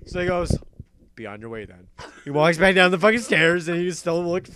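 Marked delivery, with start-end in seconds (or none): 1.2-1.9 mock stern dismissal. Retold guard dismissing them.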